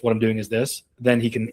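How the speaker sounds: normal talking voice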